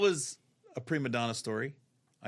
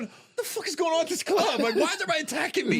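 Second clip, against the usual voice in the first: high, loud, fast disbelieving shout with laughter; exasperated outburst; 14 semitones higher, 5 dB louder, faster than usual. Retold or imitated. Comic baffled at the staff attacking him, loud high outburst. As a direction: yell it like everyone's gone crazy. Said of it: "I'm going".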